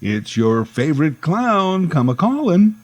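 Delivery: high, slow sing-song clown call. Retold or imitated. Clown calling for Uncle Wonky, high and slow. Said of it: "He'd say".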